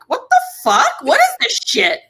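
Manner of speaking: high-pitched, exasperated outburst; outraged disbelief. Retold or imitated. Renter retelling her reaction to a missed appointment, outraged disbelief. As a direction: let it fly up high in indignant disbelief.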